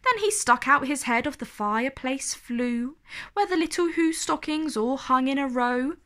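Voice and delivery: usual speaking voice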